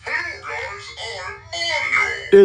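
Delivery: robot voice effect. Shown as a demonstration. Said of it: "If I wanna sound like a robot".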